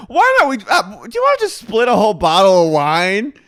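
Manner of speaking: high, loud, boozy sing-song; drunk Miranda. Retold or imitated. Miranda urging friends to split a bottle, loud boozy voice. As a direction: push the whole bottle on everyone with a big tipsy voice. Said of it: "she goes".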